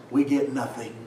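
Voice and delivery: ordinary talk, nothing marked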